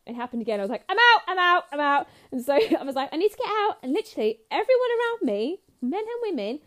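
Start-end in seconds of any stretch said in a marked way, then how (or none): 0.9-2.1 high, loud, repeated bail-out call; re-enacted yell to escape; mock panicked shout. Crowd surges again at the gig, mock panicked shout. 3.1-3.8 high, loud call for help; re-enacted cry to be let through; urgent mock plea. Fan to crowd around her after surge, urgent mock plea.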